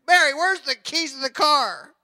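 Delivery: hammed-up wife hunting for keys; mock frantic wife. Wife asking her husband for the keys, mock panic.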